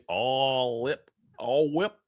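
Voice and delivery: light Aussie twang on whip; playful Australian accent. Pretend. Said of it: "that was an Australian accent".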